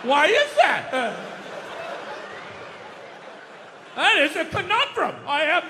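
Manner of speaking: mock puzzled hemming and hawing; dumbfounded. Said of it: "sit there like dumbfounded".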